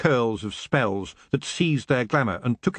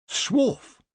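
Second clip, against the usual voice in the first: approving; 12 semitones higher than usual. Acted. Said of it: "said Nanny Ogg approvingly".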